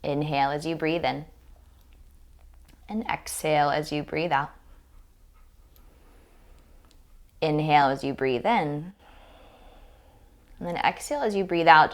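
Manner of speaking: calm, restrained breathing cues; guided meditation. Real, not acted. Yoga teacher guiding owners to breathe beside their dogs, calm meditation voice. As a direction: leave long pauses for each breath.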